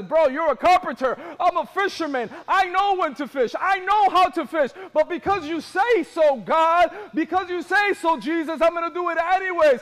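acted attitude. Peter answering Jesus's instructions about fishing, loud attitude. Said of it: "had a little attitude because".

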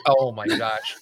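usual speaking voice

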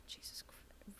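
sighed whisper under the breath; exasperated whisper. Real, not acted. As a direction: breathe it out in frustration. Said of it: "sighs, exasperated at the quote".

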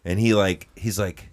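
ordinary talk

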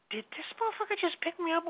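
high-pitched, mock-astonished inner voice; incredulous disbelief. Airborne, retelling his stunned thought.

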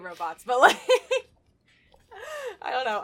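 laughing, backpedalling. Real, not acted. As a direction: laugh through it.

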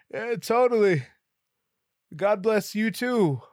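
loud, high, agreeable answer; high-pitched agreeable reply. Retold or imitated. Customer returning the clerk's blessing, high-pitched and agreeable.

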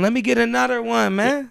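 high-pitched mock American accent. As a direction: exaggerate a casual American request. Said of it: "First American".

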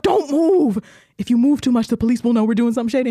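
high, fast panicked warning; frantic paranoid hush-shout at the girlfriend. Retold or imitated. Stoned narrator warning girlfriend in a high panicked rush.

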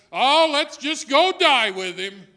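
loud, slow, resigned go-die-with-him; hammed-up fatalistic disciple. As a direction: throw it out like a doomed shrug. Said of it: "that sort of fatalistic view".

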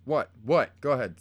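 loud call to the next room. Real, not acted. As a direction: shout it to someone offscreen. Calling to someone offscreen.

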